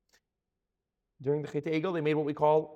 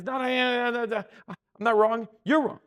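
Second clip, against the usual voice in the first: higher, louder knee-jerk protest; mock a defensive reaction, raised in pitch; defensive denial; 5 semitones higher, 6 dB louder than usual. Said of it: "our first reaction is".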